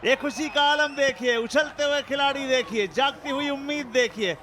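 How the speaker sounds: high, loud celebration; excited wicket call. Real, not acted. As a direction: shout it as the stumps fly. Commentator celebrates bowled wicket.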